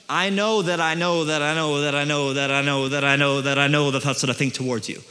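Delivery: low, steady chain on one pitch, each KNOW stamped; stamped repetition. Acted. God insisting he is sure, stamped low repetition of KNOW. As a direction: drone KNOW over and over, low and certain. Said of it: "he's stamping this thing".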